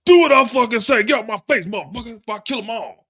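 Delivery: loud, high furious shout; Diamond going off. Retold or imitated. Diamond shouting orders at the CO, loud and furious. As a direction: bark the threat hard and high. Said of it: "he goes off and tells her".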